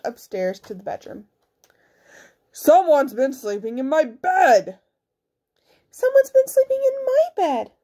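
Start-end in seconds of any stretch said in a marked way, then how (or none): 2.5-4.8 slow, emphatic big bear voice; roaring big bear. A narrator's reading. Big bear finds bed slept in, slow roar. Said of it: "All this roaring and growling". 5.9-7.7 very high mother bear voice. A narrator's reading. Mother bear finds bed slept in, high indignant voice. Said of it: "All this roaring and growling".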